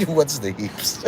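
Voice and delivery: laughing. Real, not acted. Laughing through question.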